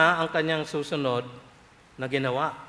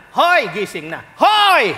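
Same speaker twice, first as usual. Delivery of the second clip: louder call building to a shrill, high shout; building parent's yell; 7 semitones higher, 10 dB louder, slower than usual. Retold or imitated. A parent yells at a child faking sleep, building to a shout. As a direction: wake the kid up, yelling harder each time.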